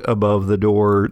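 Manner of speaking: ordinary talk, nothing marked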